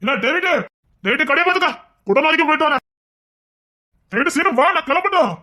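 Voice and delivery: hurried calling instructions. Acted. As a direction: call across the shop. Calling out to David.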